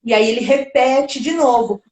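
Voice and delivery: ordinary delivery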